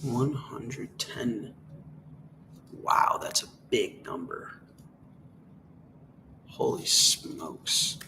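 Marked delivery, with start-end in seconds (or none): 2.7-8.0 awed whisper. Real, not acted. Awed hush at price.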